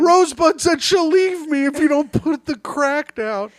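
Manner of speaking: high whiny complaint; Andy impression. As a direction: plead in a high put-on voice. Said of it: "That was a good Andy impression".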